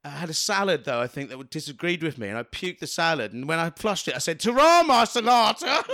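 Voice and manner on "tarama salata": loud, high, drawn-out cry; triumphant slow comic shout; shouted punchline. Acted. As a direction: belt out the pun as a big finish. Shouted punchline of a setup joke.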